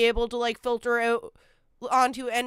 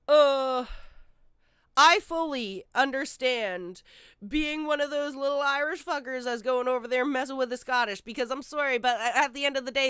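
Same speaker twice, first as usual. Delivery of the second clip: ordinary delivery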